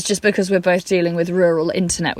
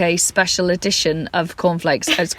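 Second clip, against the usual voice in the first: ordinary talk, nothing marked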